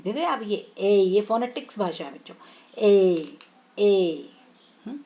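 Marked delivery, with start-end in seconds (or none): 2.8-4.3 ए held long, sliding gently down; long vowel sound. Shown as a demonstration. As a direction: draw out ए as a teacher demonstrating. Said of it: "long vowel sounds है".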